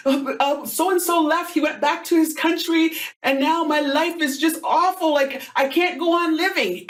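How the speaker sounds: high mock-sobbing teen voice; imitated tearful lament. Teen girl explains her boyfriend left, mimicked high tearful lament. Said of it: "she was in tears".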